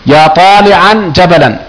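slow "طالعا جبلا", each ending on -an; sounded tanween. Shown as a demonstration. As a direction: say taali'an jabalan for "طالعا جبلا". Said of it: "الشبيه بالمضاف يبقى التنوين".

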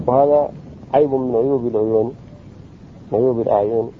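ordinary talk, nothing marked

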